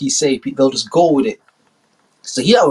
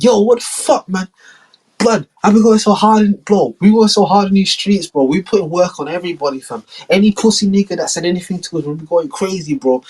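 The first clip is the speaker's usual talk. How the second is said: pitched-up mock bravado; hyped rapper; 7 semitones higher than usual. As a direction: brag like a rapper in his pomp. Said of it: "And you're like".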